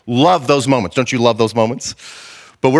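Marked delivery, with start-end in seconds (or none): none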